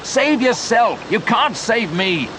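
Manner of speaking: high-pitched urgent cry; desperate urging. A narrator's reading. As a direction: plead at the top of the register. Begging friend to save himself.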